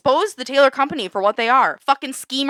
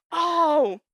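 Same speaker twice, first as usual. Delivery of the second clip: normal talking voice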